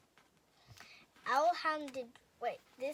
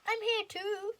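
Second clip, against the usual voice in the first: high, loud cry; 9 semitones higher, 6 dB louder than usual. A narrator's reading. Owl crying out to the frightened friends. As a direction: call out so they hear. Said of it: "Owl cried".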